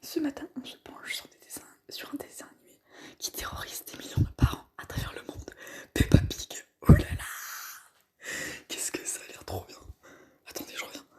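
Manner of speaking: hushed reading; whispering. Real, not acted. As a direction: read it in a whisper.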